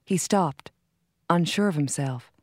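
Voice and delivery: plain reading voice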